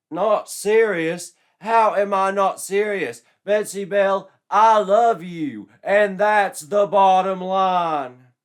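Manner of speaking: loud, high, pushy jock voice; brash jock. Acted. Joshua pressing Betsy for a date, loud and brash. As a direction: play a cocky meathead asking her out.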